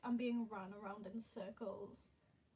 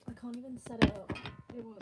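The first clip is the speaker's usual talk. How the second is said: louder and fast, exasperated; frustrated outburst; 11 dB louder, much faster than usual. Real, not acted. Streamer failing to set up the camera, loud and fast. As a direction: rush it out in frustration.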